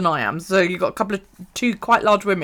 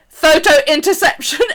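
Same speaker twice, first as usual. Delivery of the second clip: raised, higher-pitched mock announcement; retold shout; 6 semitones higher, 5 dB louder than usual. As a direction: imitate a photobomber calling out as he races past. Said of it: "he shouted as he raced".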